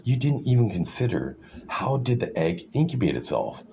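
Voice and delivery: ordinary talk, nothing marked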